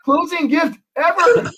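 high, slow, thrilled; delighted buyer. Retold or imitated. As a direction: stretch it out, overjoyed. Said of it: "And he goes".

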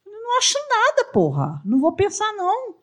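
imitating her own irritated reply, high and quick; exasperated retort. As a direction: snap back as if cornered. Retelling her blunt reply.

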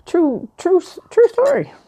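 high squeaky insistence; high emphatic "true story". Real, not acted. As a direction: pitch it up and insist. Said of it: "affirming own story".

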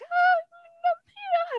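very high falsetto stalling; mock innocence. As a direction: dodge the question in a squeaky, hammed-up voice. Cassius dodges Brutus's question.

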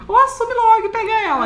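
high, fast squeal; squealed advice. Pretend. Mocking dating advice, squealed.

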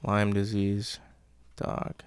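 soft, puzzled muttering to oneself; quiet musing. Acted. Girl puzzling over grandma's excuse, muttered softly. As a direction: weigh each term under your breath, with pauses.

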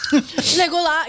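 laughing nasal Legolas call; nasally Aragorn voice. Retold or imitated. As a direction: repeat the nasal name through a laugh. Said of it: "nasally voice".

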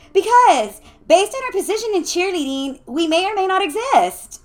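high, loud, insistent; exasperated explaining. A narrator's reading. Lucia explaining her grievance to Alyssa, exasperated. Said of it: "explained Lucia".

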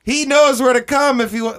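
high, loud mock panic; hammed-up fearful warning; freaked out. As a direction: imitate an alarmed partner. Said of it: "she was freaked out".

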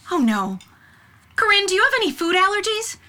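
fast, rising panic; flustered. Acted. Aunt panics about allergies.

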